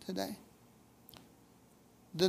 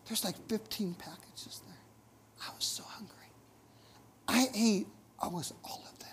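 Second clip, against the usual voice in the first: hushed whisper, like owning up to a guilty secret; whispered confession; 8 dB quieter than usual. Real, not acted. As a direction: whisper it as a sheepish admission. Confessing eating the snack cakes.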